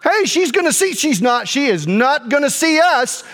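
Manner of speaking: high, mounting mock alarm; retold alarm. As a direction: replay the alarm before a crash. Alarmed as truck comes toward them.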